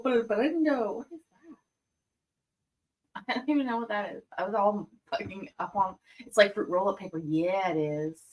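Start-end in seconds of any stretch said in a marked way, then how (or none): none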